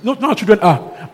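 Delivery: normal talking voice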